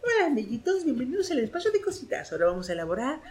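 put-on high, soft kids' TV host greeting; sing-song children's show host; bright squeaky sing-song welcome. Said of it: "Era una actriz que salía".

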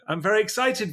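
high, bright mock-excited voice. Shown as a demonstration. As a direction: pop up high like you're thrilled.

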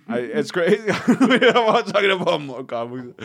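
high, loud laughing protest; cracking up. Real, not acted. Comic catching himself in a nerdy rant, cracking up. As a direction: laugh helplessly through the words.

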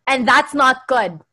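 mock the teacher's stern warning; teacher's scolding. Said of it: "She said".

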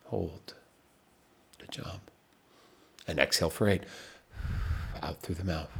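calm counting coach, nearly whispering by the end; guided breathing. Acted. Leads a timed breathing exercise.

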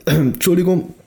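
strained, fading voice after clearing throat; voice giving out. Real, not acted. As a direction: let the voice crack and thin out. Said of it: "meine Stimme geht weg". (Stimme versagt, heiser und brüchig)

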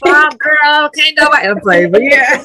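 loud, high-pitched joking outburst; playful exclamation. Real, not acted. Guest joking with host about rap lyrics, playful. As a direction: crow it high and loud, laughing along. Playful reaction to host's teasing.

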